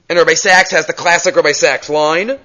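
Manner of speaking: ordinary delivery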